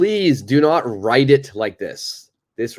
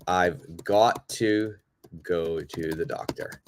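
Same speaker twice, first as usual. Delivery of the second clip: slow clear teaching model; 6 semitones lower, 5 dB quieter, slower than usual. Shown as a demonstration. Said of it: "I could say".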